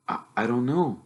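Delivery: put-on nervous boy asking his uncle; young Harry, unsure and polite; timid boy.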